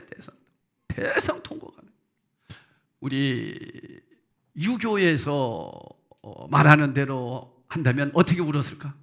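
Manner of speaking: ordinary talk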